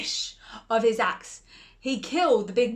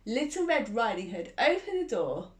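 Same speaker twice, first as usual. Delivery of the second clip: ordinary delivery, nothing marked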